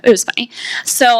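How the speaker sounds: normal talking voice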